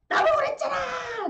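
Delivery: high, loud accusing yell; furious shout. Acted. Blind man accusing the stranger, shouting. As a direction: shout it at him.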